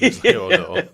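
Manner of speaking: evil villain name. Pretend. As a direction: announce it like a mock villain. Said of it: "sounds like [name]'s evil brother".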